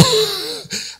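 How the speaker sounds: light high chuckle; soft amused laugh. Real, not acted. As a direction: laugh at the thought. Preacher laughs.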